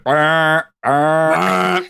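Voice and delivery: loud honking bah; foghorn imitation. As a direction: honk it like a fog horn. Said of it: "I'm just walking around going".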